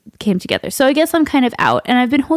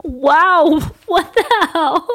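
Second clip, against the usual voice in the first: nervous laughter; 8 semitones higher than usual. Real, not acted. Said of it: "I don't know why I'm so nervous".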